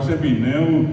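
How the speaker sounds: usual speaking voice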